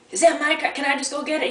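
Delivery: calling out across a dark tow lot; yelling across the lot. Retold or imitated. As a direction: shout the question over the noise. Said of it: "so I yell at the guy".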